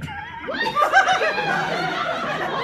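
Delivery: a very high, helpless shrieking laugh. Real, not acted. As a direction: lose it completely laughing. Laughing at the fanfic.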